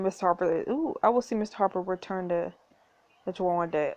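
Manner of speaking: ordinary delivery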